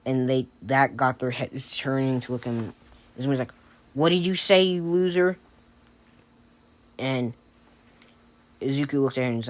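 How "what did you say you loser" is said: higher, louder comeback; raised-voice taunt; indignant challenge. Acted. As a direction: fire back as if insulted. Izumi snaps back at insult.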